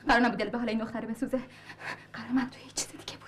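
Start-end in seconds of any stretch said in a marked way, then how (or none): 2.1-3.3 whisper. Acted. Secret deal said aside.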